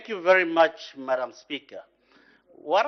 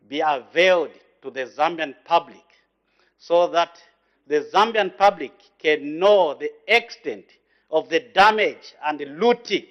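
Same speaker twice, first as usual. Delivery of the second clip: usual speaking voice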